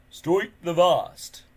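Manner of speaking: slow, loud, grand chief; booming chief. A narrator's reading. Stoic proudly announces himself to the stranger, slow and loud. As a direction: announce the name grandly and slowly.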